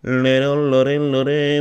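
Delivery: a light nasal, throaty [name] imitation, slow; imitating [name]'s voice. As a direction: hum the syllables like [name], slowed down. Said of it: "un poquito así". (imitando a [name], un poquito nasal y engolada)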